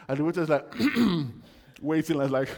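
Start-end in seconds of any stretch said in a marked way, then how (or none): 0.8-1.4 waiter clears his throat to hurry them; a loud, impatient ahem; pointed throat clearing. Retold or imitated. Waiter clearing throat at dithering diners.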